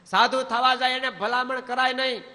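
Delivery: high, loud sermon point; preaching. Acted. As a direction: drive the moral home loudly. Preaching at full force.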